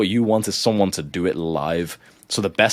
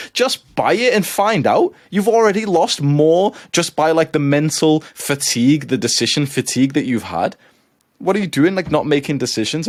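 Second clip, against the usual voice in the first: higher and fired up, scolding; heated rant; 8 semitones higher, 4 dB louder than usual. Real, not acted. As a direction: shake them out of hesitation. Ranting at hesitant viewers.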